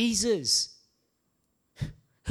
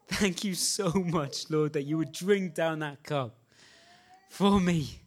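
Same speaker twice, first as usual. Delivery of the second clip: softer, choked-up thanks; tearful prayer; 5 semitones lower, 3 dB quieter than usual. Real, not acted. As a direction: pray through tears. Emotional prayer, crying heard.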